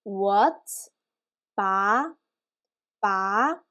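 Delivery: "What" and "ba" each rising like questions; second tone. Shown as a demonstration. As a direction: glide up on "What", then match it on "ba". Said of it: "That's our second tone".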